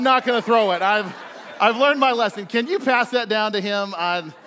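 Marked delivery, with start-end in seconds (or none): none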